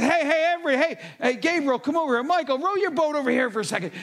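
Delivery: high, loud calling across the sky; eager shout to the crowd; excited calling out. Acted. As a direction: call everyone over, thrilled. God calling angels over.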